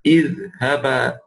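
idhhaba said slowly, each sound clear; slow teaching demo of an Arabic verb; Arabic verb drilled. Shown as a demonstration. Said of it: "Antuma is".

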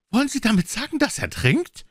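high, excited outcry as a question; agitated squire, pitched far up; exclaiming squire. A narrator's reading. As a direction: cry out in high-pitched disbelief. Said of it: "rief der Squire".

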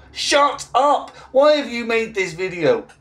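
high-pitched exasperated outburst; exasperated telling-off. Acted. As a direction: scold the video maker, pitch shooting up. Scolding the absent video maker.

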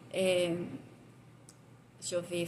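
ordinary delivery

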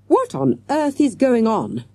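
a restrained narrator's shout. A narrator's reading. Teacher running towards an uproar, mild shout. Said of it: "shouted Mister Jones".

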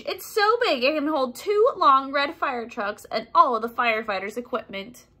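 ordinary delivery, nothing marked

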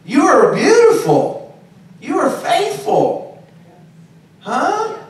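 high, joyful praise that climbs; rising joyful praise. Acted. Preacher thanking God for the sunny event, joyful and high.

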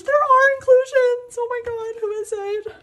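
very high, loud excited squeal; thrilled shriek. Real, not acted. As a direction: shriek it out in fan joy. Discovers the photocard inclusions.

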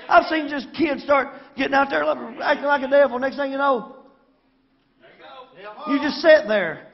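fiery sermon delivery; preaching at full force. Acted. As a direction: preach it hard at the congregation. Preaching to the congregation.

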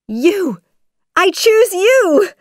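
very high, loud and excited; joyful exclamation. A narrator's reading. Jasmine picks Aladdin as husband, squealing with excitement. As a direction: exclaim your choice with delight.

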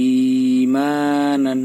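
ইমানান with both the first and second vowels drawn out slowly; madd lengthening. Shown as a demonstration. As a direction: stretch ই and the মা of ইমানান. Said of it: "একালিপ টান দিতে হবে প্রথমটায় এবং দ্বিতীয়টায়". (ইমানান প্রথম ও দ্বিতীয় অক্ষরে টান)

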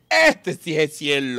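put-on savoring drunk. Drunk savoring heavenly bar.

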